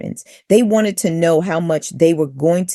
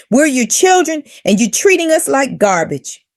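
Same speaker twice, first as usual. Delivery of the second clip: angry; 8 semitones higher than usual. Retold or imitated. Angry text read aloud.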